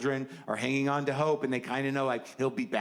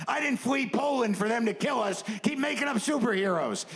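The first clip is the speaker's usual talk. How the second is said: immigrant elder voice; 9 semitones higher than usual. Acted. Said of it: "The more modern version of that".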